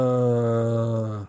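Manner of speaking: low drawn-out zombie groan. Shown as a demonstration. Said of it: "like a zombie noise".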